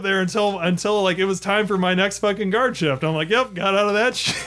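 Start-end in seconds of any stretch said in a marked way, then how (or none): 3.3-4.5 laughing. Real, not acted. Laughing at own escape.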